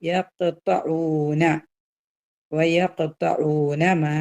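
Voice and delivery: very slow chant with a bounced ഖ in യഖ്തഊന; qalqala demo. Shown as a demonstration. Teacher showing students qalqala, slow chant. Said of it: "ഖാഫിന് ഖൽഖല".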